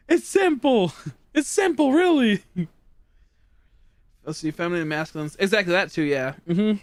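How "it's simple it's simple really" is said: high, teasing it's-so-obvious voice; hammed-up high insistence that it's easy; mock exasperation. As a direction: pitch it up as if it were obvious. Mocking own explanation.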